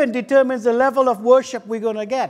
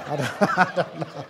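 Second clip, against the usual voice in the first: low, soft words through a laugh; 7 semitones lower, 8 dB quieter than usual. Real, not acted. Preacher stumped by pigeon's feet, laughing. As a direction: chuckle helplessly while admitting it. Laughing at own wrong guess.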